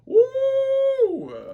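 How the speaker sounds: very high hyped whoop; excited woo. Real, not acted. Viewer hyped by a muscle shot, very high whoop. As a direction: let out a hype whoop. Hype at six-pack shot.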